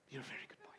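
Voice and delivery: breathy whisper, barely voiced; whispered repeat. [name] whispering reassurance to the boy Sid. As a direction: let the repeat sink to a whisper.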